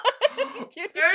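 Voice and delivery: sharp high gasp breaking into a laugh; gasp, thrilled and laughing; delighted gasp. Real, not acted. Hearing the jab at Kua's ring, a squealing gasp.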